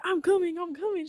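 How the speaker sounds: high, hurried-sounding imitation of a friend; urgent reassurance. Best friend coming to back her up, high urgent imitation. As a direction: act out a friend rushing over. Voices friend rushing to help.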